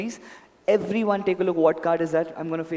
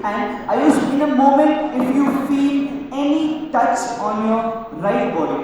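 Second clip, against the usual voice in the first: loud stage instruction; 6 semitones higher, 7 dB louder, slower than usual. Real, not acted. Magician instructing volunteer loudly on stage.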